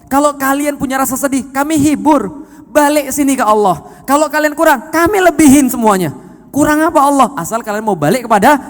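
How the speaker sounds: loud, high, rising sermon fervour; impassioned preaching. Retold or imitated. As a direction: call out the promises, building in force. Preaches God's promises in first person.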